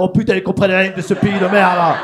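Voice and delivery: loud exasperated rant; losing his temper. Acted. Marseillais contestant cursing the country, loud mock anger. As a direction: blow up in mock outrage. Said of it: "le gars, il s'énerve".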